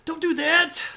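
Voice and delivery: loud, high-pitched mock scolding; hammed-up high wifely protest; impression of wife. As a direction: yelp it like an exasperated wife. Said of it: "one of the things that she says very frequently is".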